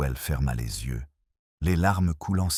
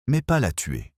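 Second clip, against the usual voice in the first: lightly insistent protest, pitched higher; 6 semitones higher than usual. A narrator's reading. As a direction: protest with a high start that falls away. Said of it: "insista madame Cresswell".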